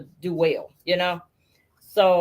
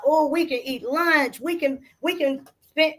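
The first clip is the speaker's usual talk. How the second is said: put-on higher, lighter happy-child voice, slowed on the first line; excited kids; 6 semitones higher than usual. Mother voicing her kids thrilled over lunch money, happy child voice. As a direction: lightly play the giddy children.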